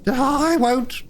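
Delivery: high, meek washerwoman. A narrator's reading. Toad as washerwoman.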